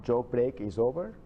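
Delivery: ordinary talk, nothing marked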